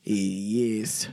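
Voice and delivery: put-on low, quiet Russian accent; put-on Russian, deep and soft; mock Russian accent. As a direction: drop down into a hushed Russian accent. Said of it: "Do, like, a Russian accent".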